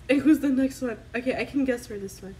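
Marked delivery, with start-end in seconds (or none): none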